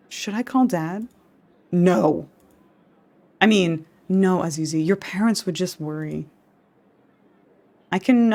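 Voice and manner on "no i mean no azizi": ordinary delivery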